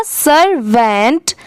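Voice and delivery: Servant slowly as sir, then vent; slow teacher's sir-vent for servant; syllables put together. Shown as a demonstration. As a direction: break servant into two drawn-out parts. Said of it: "पूरा मिला देंगे".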